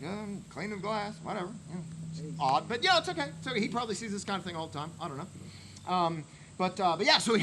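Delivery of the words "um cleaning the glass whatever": flat, uninterested mumble; a lightly voiced bored bartender, quieter. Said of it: "Bartender's like".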